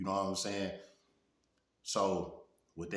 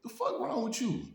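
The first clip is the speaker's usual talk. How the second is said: high, incredulous snap at her; exasperated scold. Retold or imitated. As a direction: demand an explanation, voice up. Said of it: "I'm like".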